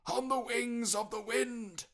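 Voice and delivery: high, loud wailing spectre; loud anguished ghost cry. Acted. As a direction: moan it like a tormented ghost. Ghost voice in story.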